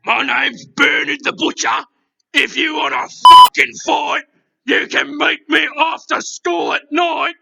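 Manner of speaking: hammed-up thug picking a fight; Bernard the Butcher. Bernard the Butcher threatening a fight in a loud thug voice. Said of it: "Bernard, stop swearing".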